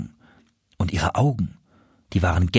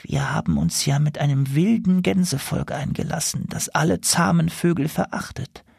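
higher, feeble weak voice; 6 semitones higher than usual. Acted. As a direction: speak weakly, a little higher. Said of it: "mit schwacher Stimme".

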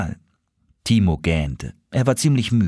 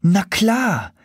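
hoher Sophie-Ton; 10 semitones higher than usual. Acted. Sophie's voice before the title.

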